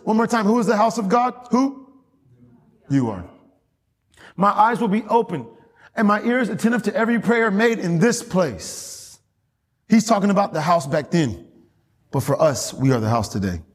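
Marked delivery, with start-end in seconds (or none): 4.4-9.2 high ringing pulpit reading; preached scripture. Acted. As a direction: proclaim God's words from the pulpit. God's words proclaimed again.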